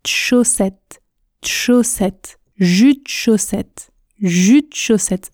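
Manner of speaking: "jus de chaussette" said as jut-chaussette; fuse de into a tch before "chaussette"; dropped E. Shown as a demonstration. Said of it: "Je ne prononce pas le E du mot de". (« jus de chaussette » sans le E de de)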